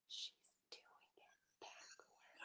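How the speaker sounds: slow imitated whisper, barely audible; drawn-out mock whisper; very quiet. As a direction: whisper it slowly, mimicking a hushed onlooker. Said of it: "very quietly like".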